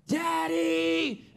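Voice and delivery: put-on high, loud child's cry for his father; child yelling Daddy. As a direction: call out like a scared kid in the dark. Son screaming at three a.m.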